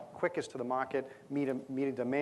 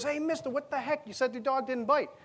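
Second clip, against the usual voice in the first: much higher, squealing protest; outraged yelp; 12 semitones higher than usual. Retold or imitated. Bitten visitor protests to the local, squealing high. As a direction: yelp it like you're being bitten.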